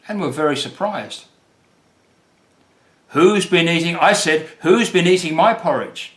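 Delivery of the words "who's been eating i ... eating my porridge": higher, louder, indignant bear question; lightly voiced Papa Bear demanding an answer; Papa Bear asking. A narrator's reading. Papa Bear finding his porridge eaten, louder indignant voice. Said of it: "asked Papa Bear".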